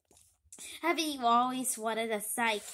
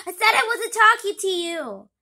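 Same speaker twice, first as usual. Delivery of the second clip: loud, higher exasperated yell; irritated shout; 6 semitones higher, 12 dB louder than usual. Acted. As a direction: lose patience and shout. Villain snaps at interrupting kid.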